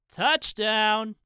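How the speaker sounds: distant yell. A narrator's reading. A player yelling the score, a high yelled cheer. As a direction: call it out high like a celebrating player. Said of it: "someone yelled".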